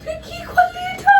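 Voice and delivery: slow, high crying shout; husband seeing the smashed TV, crying out high and slow. Acted. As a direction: wail it out in shock at the damage. Wife smashes the TV.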